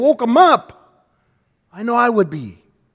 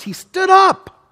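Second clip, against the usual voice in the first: dramatic; 11 semitones higher, 6 dB louder, slower than usual. A narrator's reading. Dramatic retelling of the storm.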